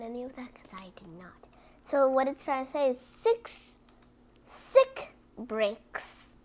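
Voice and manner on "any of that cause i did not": fast hushed aside; confiding whisper. Real, not acted. Reader confiding to kids in a whisper.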